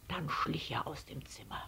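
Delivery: soft whispered narration; hushed sneaking. A narrator's reading. Narrator following Herr Letscher out, hushed sneaking. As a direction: whisper it like tiptoeing out.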